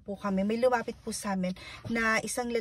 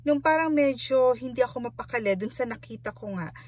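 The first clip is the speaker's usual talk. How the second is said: usual speaking voice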